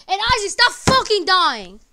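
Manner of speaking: loud, slow scolding shout; frustrated player yelling at teammate Isaac; angry yell. Real, not acted. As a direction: bark the order at Isaac.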